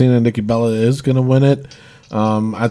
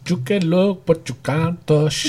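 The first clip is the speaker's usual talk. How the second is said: mock foreign accent, softer and drawn out; Maryse; 4 semitones higher, 5 dB quieter, slower than usual. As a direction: put on her accent, stretching the last words slowly. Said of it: "she said it with that accent".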